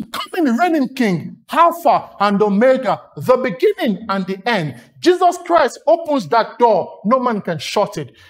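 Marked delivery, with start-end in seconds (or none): none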